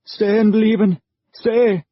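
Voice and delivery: high, loud, nervous barked order; barked command. Acted. Nervous soldier cocks his gun and barks at Maddie to stay, high and loud. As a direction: bark at her to freeze. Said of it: "he barked".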